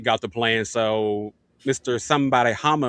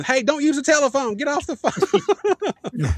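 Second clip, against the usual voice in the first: much higher, slow mock mom scolding; nagging mom; 13 semitones higher than usual. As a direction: play a mom telling the kid off the phone. Parent yelling about dial-up.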